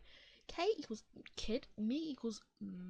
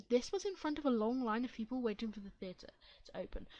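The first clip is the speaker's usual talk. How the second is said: plain reading voice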